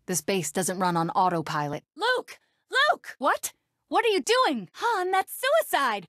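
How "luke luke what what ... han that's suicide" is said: high-pitched, alarmed cries; urgent, incredulous high cries; alarmed calling out. Acted. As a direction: call out in alarm. Calls out to companions in alarm.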